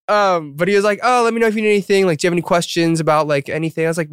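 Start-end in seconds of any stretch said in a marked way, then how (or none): 1.0-3.9 quick, high Aussie-ish imitation; light Aussie accent, fast and high. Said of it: "in his Aussie accent".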